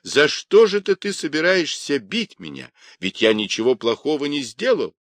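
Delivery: high, indignant protest; boy shouting. Acted. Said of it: "кричал Юньге".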